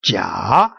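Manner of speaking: Jia dipping low, then rising; low scooped Jia with an upturned end; third tone. Shown as a demonstration. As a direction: take Jia down deep and bring it back up. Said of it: "is going down and then going upward".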